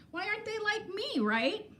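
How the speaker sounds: smug couch critic laughing at the people on TV; mocking judgmental self. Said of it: "I could be like".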